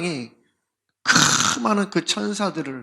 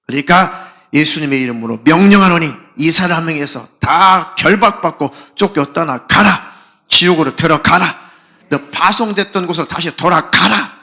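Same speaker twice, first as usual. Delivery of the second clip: exorcist's command; 7 dB louder than usual. Retold or imitated. Preacher commanding the demon in Jesus' name, loud. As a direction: cast the spirit out with full authority.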